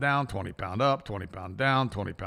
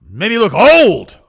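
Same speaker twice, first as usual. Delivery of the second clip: imitating his mother; 8 semitones higher, 15 dB louder, slower than usual. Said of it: "She goes".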